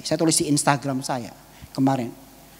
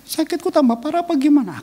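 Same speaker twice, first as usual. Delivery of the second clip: raised, high-pitched tearful pleading; tearful wife; 11 semitones higher, 3 dB louder than usual. Retold or imitated. Crying wife asking her husband about her illness, high-pitched and tearful. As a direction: imitate an anxious wife in tears. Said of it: "Istri saya tambah nangis kan".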